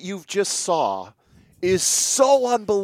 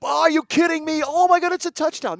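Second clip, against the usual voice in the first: much higher, louder overexcited commentator; mock excited announcer; 12 semitones higher, 4 dB louder than usual. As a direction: ham up a touchdown call as a hyped announcer. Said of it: "No, he just told it what happened".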